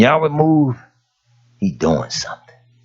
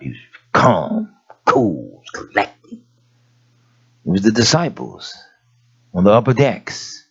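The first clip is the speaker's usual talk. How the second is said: normal talking voice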